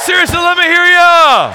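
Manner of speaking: really crank it up and demand the cheer; full shout. Real, not acted. Pastor pushing the congregation for a bigger cheer, full shout. Seriously, let me hear ya.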